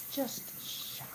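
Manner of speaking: breathless whisper, nerves frayed. Real, not acted. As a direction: whisper it like you're worn out. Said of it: "my nerves are shot".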